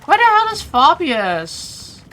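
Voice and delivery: loud, high, drawn-out exasperation; exasperated. Real, not acted. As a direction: call out in real frustration at not finding him. Frustrated hunt for game character.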